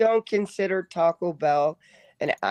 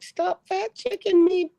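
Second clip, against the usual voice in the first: high, slow, whiny mock protest; mock indignant; 11 semitones higher than usual. Joking complaint to the friend who corrected him, mock whine. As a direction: pretend to be offended, drawn out. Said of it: "I'm kidding".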